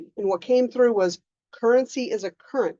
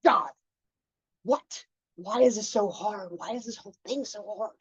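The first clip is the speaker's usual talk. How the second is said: bratty whine; 4 dB quieter than usual. Retold or imitated. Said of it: "my little bratty moment".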